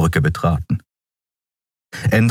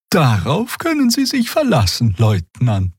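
high-pitched, obliging assurance; friendly Xea; 8 semitones higher than usual. A narrator's reading. Character promising the guardswoman to report, friendly. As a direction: reassure the officer in a high, friendly voice. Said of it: "versicherte Xea freundlich".

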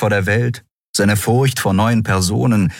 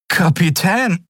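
high, lightly voiced boy; hesitant young crewman; 13 semitones higher, slower than usual. A narrator's reading. Miko begins to address his captain, hesitant. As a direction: start to address her, drawn out. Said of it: "begann Miko".

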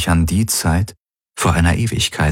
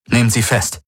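higher, cold order; a hint of cold command; 6 semitones higher than usual. A narrator's reading. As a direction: arrest her without mercy. Possessed lord orders arrest.

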